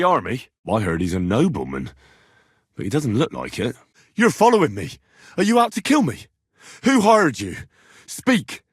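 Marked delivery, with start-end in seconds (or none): 4.1-8.6 high, loud and suspicious, demanding answers; alarmed challenge. Acted. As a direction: confront someone you think was sent to kill you. Confronting a suspected hired killer.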